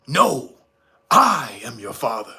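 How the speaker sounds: deep, measured Vader reveal; Darth Vader. Retold or imitated. Vader revealing he is Luke's father, deep and grave. Acted Luke and Vader scene.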